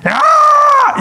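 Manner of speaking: high, loud mock scream; mock yell. Preacher imitating someone texting in all caps, mock yell. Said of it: "texting somebody and yelling at them".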